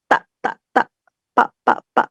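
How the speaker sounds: "tak" and "pak" short with a throat jerk; tense consonants. Shown as a demonstration. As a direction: cut each "tak" and "pak" off sharply. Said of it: "sentakan pada tenggorokan". ("tak, pak" tegang dan pendek)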